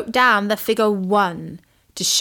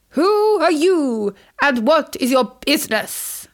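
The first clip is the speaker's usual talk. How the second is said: pompous voice; 5 semitones higher than usual. Acted. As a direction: puff up like a grand official. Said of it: "the hare put on a pompous voice".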